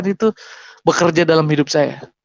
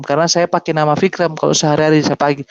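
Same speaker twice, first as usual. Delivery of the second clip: usual speaking voice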